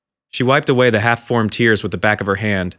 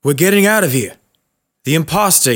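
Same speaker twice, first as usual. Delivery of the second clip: put-on agitated old man; 5 semitones higher than usual. Confused old father insists.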